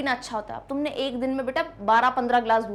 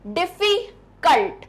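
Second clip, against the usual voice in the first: Difficult split as डिफी, cult; syllable by syllable; 3 semitones higher, much slower than usual. Shown as a demonstration. Teacher breaking up the next word, syllable by syllable. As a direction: pause inside difficult before cult. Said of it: "words को तोड़ना आ रहा है".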